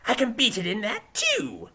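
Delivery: high, loud, drawn-out protest; Weevil. A narrator's reading. Defendant insisting to the judge, high and defensive. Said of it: "Weevil said".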